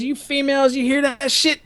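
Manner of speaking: high-pitched mock indignation; outraged instructor. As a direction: imitate a superior calling on witnesses. Instructor addressing female cadets, retold.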